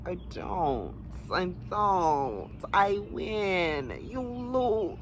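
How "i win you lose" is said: taunting. Acted. Taunting sing-song.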